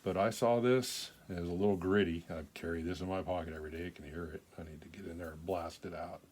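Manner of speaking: usual speaking voice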